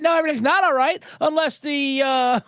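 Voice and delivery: high, lightly voiced fussy bird expert; Brian Regan impression. Birdwatcher complaining to the network, lightly mock indignant and high. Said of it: "what he says".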